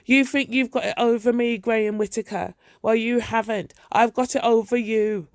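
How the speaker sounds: put-on old mother yelling through the door at her son; shouting mother. A narrator's reading. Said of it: "she comes shouting outside the door".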